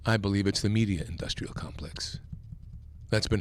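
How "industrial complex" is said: whispered like a secret; hushed confiding whisper; conspiratorial whisper. Real, not acted. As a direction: lean in and whisper it. Confiding claim, voice drops.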